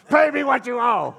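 screamed high and loud with a laugh; furious yell. Shown as a demonstration. Preacher acting the servant who chokes his debtor, shouting. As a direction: roar the demand at him. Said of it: "I just wanted to do this".

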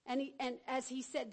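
usual speaking voice

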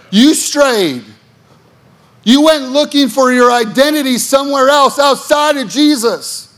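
high, loud pulpit preaching; fervent sermon climax; preaching at full force. Acted. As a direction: call the straying flock back.

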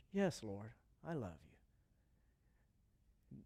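very soft, low and slow; humble confession. Acted. Peter answers Jesus on the shore, very soft and slow. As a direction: barely breathe it out, heartfelt.

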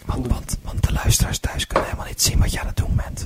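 breathy ASMR whisper. Shown as a demonstration. Doing ASMR for the podcast, whispering to Bart. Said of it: "Zo'n funzig fluisterprogramma".

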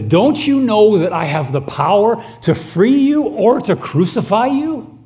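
high, loud, exasperated demand; Pilate's frustrated outburst. Acted. Pilate shouting at Jesus in frustration, high and loud. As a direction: Pilate losing patience with the silent prisoner.

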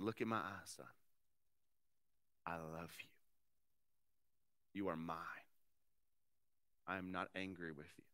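soft, hushed and loving; tender whisper to son. Retold or imitated. Father whispering love to his crying son, tender and hushed. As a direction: murmur it close, like holding a child.

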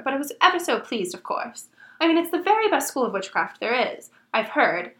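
brisk know-it-all girl impression; Emma Watson. Reader does Hermione introducing herself, impression voice. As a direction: rattle it off like an eager schoolgirl, lilting. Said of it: "do it in my Emma Watson impression voice".

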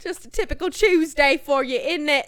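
loud, high teasing Australian; hammed-up Aussie tease; mock Aussie accent. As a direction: needle a mate in Aussie. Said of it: "Aussie bit continues".

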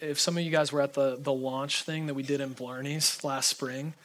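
ordinary delivery

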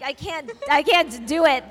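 usual speaking voice

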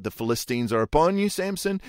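mock alarm, rising on Samson; crying out. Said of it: "She cries out".

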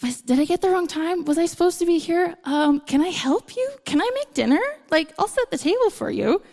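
an unsure guest trying to help; mildly voiced nervous guest; awkward guest. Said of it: "And you're like".

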